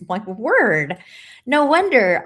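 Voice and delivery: mock dismay. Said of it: "I'm like".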